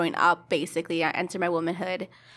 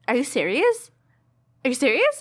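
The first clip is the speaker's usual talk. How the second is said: retold disbelief; 5 semitones higher, 4 dB louder than usual. Mother's disbelief at the news, retold.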